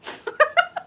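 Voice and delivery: short high laugh; high giggle. Real, not acted. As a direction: giggle at your own insult. Laughing after insult.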